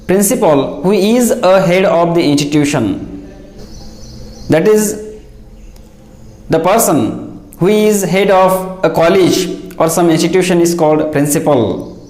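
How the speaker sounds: ordinary talk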